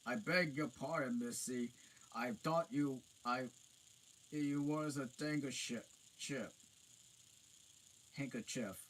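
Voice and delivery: high loud apologetic giant. A narrator's reading. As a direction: fluster like an embarrassed giant.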